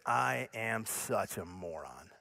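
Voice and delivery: low, soft, slow self-blame; dejected self-reproach. Acted. Speaker voicing his own thought after failing, low soft dejection. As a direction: sink into quiet exasperation with yourself. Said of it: "the thought and the feeling is this".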